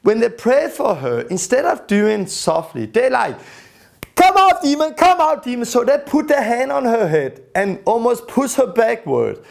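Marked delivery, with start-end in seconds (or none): 4.2-5.7 loud, high commanding yell at the demon; commanding shout. Retold or imitated. Praying men order the demon out with a shout. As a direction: bark it out like an exorcist. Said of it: "became loud and command even more".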